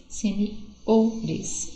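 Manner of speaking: "seniores" split up, with a strong O before "res"; stress on second-last syllable. Shown as a demonstration. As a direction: lean on the O: se-ni-O-res. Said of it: "segunda sílaba forte". ("seniores" destacando a sílaba forte O)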